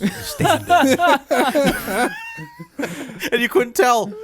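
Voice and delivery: high, laughing through the words; giggling while telling. Real, not acted. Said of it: "to make myself laugh".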